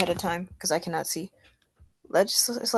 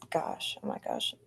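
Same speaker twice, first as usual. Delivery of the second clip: exasperated whisper; 13 dB quieter than usual. Real, not acted. Stumbling over the reading.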